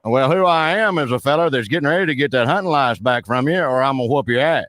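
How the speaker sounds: hammed-up backwoods Southern caller; put-on rural Southern accent. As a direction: play a blustering Southern good old boy on the phone. Roy Mercer character voice held.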